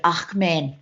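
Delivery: put-on light South African accent on "Ach, man". South African mum addressing the room, lightly accented. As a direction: slip into a South African mum's voice. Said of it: "I can't keep going with the accent".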